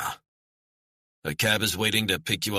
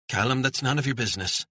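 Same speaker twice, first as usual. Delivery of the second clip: put-on higher, mother scolding her boy; 7 semitones higher than usual. A narrator's reading. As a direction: tell the child off in public. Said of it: "scolding her son".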